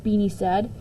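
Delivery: plain reading voice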